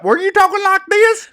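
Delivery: high, loud teasing Southern twang; mock Southern accent. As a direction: tease with a hammed-up Southern accent. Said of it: "my Southern accent".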